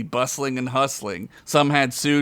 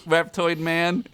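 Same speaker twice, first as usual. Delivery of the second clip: ordinary talk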